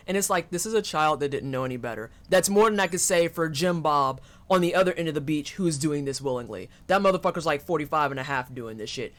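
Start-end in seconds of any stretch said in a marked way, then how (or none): none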